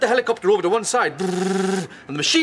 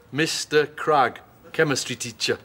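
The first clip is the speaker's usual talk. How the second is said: slow, lower, word by word as typed; typing it in; 6 semitones lower, slower than usual. Pretend. Typing his old teacher's name into the attack computer, slow and lower. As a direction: spell it out like keying in a target. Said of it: "I'd type into the attack computer".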